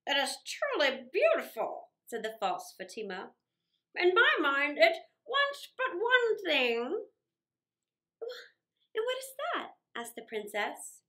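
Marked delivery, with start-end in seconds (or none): 0.1-1.9 a lightly altered, sly false holy woman; disguised magician voice. Acted. Disguised magician admiring the hall, sly voice. 4.0-7.1 higher, slow and sly, drawn out; scheming false Fatima. Acted. False Fatima tempting the princess, slow and sly. 9.0-9.8 high, eager little question; curious princess. Acted. Princess asking the disguised guest, high and curious.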